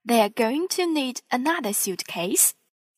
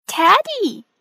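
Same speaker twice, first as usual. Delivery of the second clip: high, loud joyful cry of the name; Teddy! called out in delight, pitched high; 10 semitones higher, 8 dB louder than usual. A narrator's reading. Peppa greeting her returned bear with a high, loud happy cry. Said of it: "cries Peppa".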